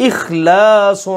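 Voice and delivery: اخلاص slow with a thin light ل; light laam. Shown as a demonstration. استاد طالب علموں کو اخلاص کا لام باریک پڑھ کر دکھاتا ہے، آہستہ. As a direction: draw out اخلاص keeping the ل light. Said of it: "اس کو ہم باریک کر رہے ہیں".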